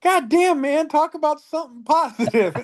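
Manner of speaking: high, loud exasperated retort; exasperated Cody. Retold or imitated. Rider fed up with his friend's teasing, high exasperated retort. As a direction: throw up your hands, fed up. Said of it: "And he goes".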